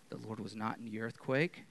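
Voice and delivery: ordinary talk, nothing marked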